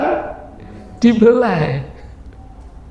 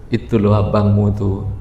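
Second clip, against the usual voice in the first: lower, sulky complaint; wife complaining; 13 semitones lower than usual. Acted. The neglected wife complains to Salman about her husband, lower voice.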